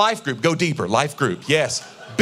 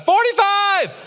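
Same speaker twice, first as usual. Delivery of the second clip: much higher, louder shouted number; mock shout; 6 dB louder, much slower than usual. Latecomer shouting his answer, loud and high. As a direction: blurt it out late, loud and high. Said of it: "just shout out".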